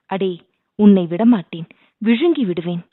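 ordinary delivery, nothing marked

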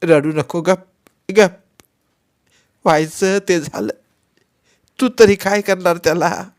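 put-on a weeping old woman consoling a child; grandmother weeping. A narrator's reading. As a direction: soothe the boy through her own tears. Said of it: "रडत रडत ती म्हणाली".

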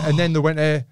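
usual speaking voice

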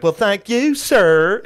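very high, loud Southern drawl; Zach Galifianakis southern accent. Acted. Pizza manager answering a sarcastic customer, hammed-up Southern accent. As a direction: sing out mock courtesy in a Southern voice. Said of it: "Zach Galifianakis do his southern accent".

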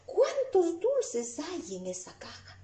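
high, slow exclamation of amazement; high-pitched, stretched-out surprise; surprised. Acted. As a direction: act someone astonished, drawing the words out. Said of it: "Alguien se sorprende diciendo".

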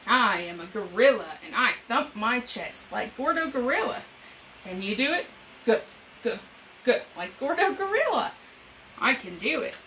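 put-on bright, lively read-aloud for young kids; storytime reading. Reading an animal picture book to children, bright storytime voice.

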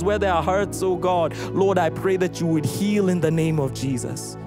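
ordinary delivery

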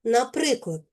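ordinary delivery

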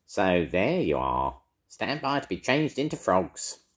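slow, menacing witch threat; a wicked witch sneers at them; screeching witch. Acted. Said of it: "a familiar voice that screeched".

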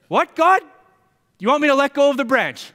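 high, loud, incredulous question; alarmed disbelief. Acted. Jack questions God's order to let go, alarmed disbelief. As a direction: cry out in shock at the order.

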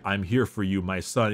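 low, grave fatherly voice; a hint of a booming emperor; solemn father. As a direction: intone it like a noble long-lost dad. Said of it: "he's like".

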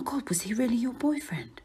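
hushed voiceless whisper. Acted. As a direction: ask it in a whisper. Whispered question.

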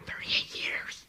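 hushed whisper. Acted. Jesus whispering to the sick man by the pool. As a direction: breathe the words in awe.

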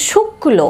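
শুক্ল with a doubled k; stressed k sound. Shown as a demonstration. Teacher recapping the rule for learners, শুক্ল with stressed k. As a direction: press on the ক in শুক্ল, then release the l. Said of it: "কয়ের উচ্চারণটা একটু জোর হচ্ছে".

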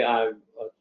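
usual speaking voice

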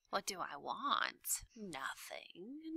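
slow, silky villain rat; sly rat voice. A narrator's reading. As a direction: purr it slyly. Roscuro sly.

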